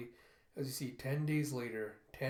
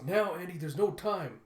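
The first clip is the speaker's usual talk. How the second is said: urgent; 5 semitones higher, 7 dB louder than usual. Retold or imitated. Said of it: "she goes".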